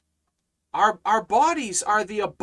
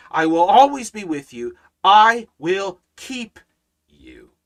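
emphatic proclamation; 8 dB louder than usual. Acted. Climax of preached promise.

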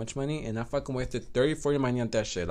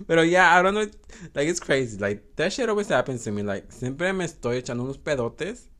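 ordinary delivery

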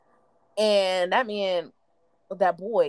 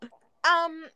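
birdish voice; 8 semitones higher than usual. Retold or imitated. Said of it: "It was something real birdish".

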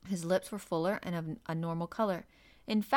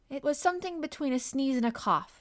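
put-on higher, prim and offended girl's voice; reproachful dignity; 7 semitones higher, 4 dB louder than usual. Mary covering her stifled laugh before the doctor, reproachful and dignified. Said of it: "she replied with reproachful dignity".

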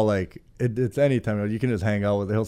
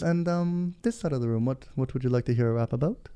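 softer, hesitant dorky host; super dorky performer; 7 dB quieter than usual. Retold or imitated. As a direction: mumble the question like an awkward nerd. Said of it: "he's just super dorky".

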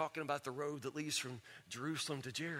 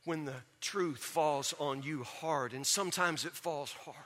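usual speaking voice

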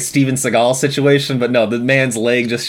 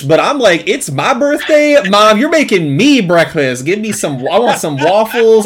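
put-on higher, louder, whiny demanding kid; bratty birthday kid; 7 semitones higher, 4 dB louder than usual. A kid demanding his mom make birthday waffles, whiny and loud. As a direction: whine for waffles like a spoiled kid.

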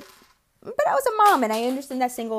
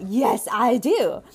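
emphatic yes; 7 dB louder, much slower than usual. Retold or imitated. What she would have answered.

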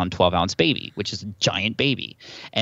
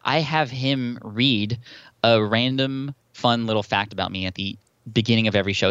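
ordinary talk

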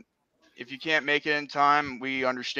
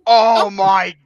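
slow, loud, laughing oh my God; laughing disbelief; 4 semitones higher, 12 dB louder, much slower than usual. Real, not acted. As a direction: crack up in disbelief. Reacting to Grimace roast.

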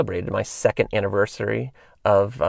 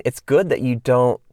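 ordinary delivery

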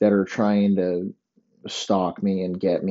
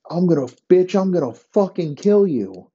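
high, loud pretend rage at a girlfriend; mock furious threat; 10 semitones higher, 3 dB louder than usual. Pretend boyfriend threatening his crying girlfriend, loud high angry yell. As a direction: scream the threat like you've lost it.

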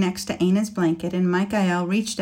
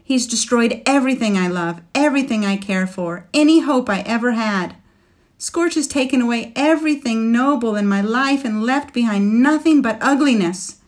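louder and higher, fury close to tears; fierce, near tears; 4 semitones higher, 6 dB louder than usual. A narrator's reading. Woman raging at the dragon who ruined her life, near tears. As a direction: rage building toward tears. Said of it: "She was nearly crying now".

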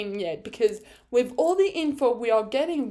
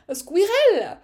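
high, louder driver impression; Esteban; 8 semitones higher, 5 dB louder than usual. Fan repeating the French driver impression, high and loud. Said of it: "I can actually do Esteban".

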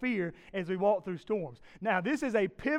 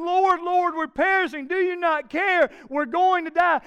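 loud, high terrified cry; yelling in panic; 9 semitones higher, 9 dB louder than usual. Retold or imitated. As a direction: scream it like the boat is sinking. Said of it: "another disciple yelling".

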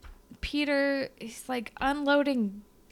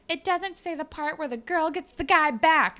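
lightly voiced girl; 7 semitones higher, 4 dB louder than usual. Retold explanation.

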